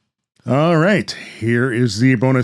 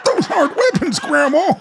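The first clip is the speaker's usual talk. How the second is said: put-on much higher, incredulous boy voice; a kid protesting in disbelief; exasperated young Tom. Tom to Grandma about her weapons, incredulous and high.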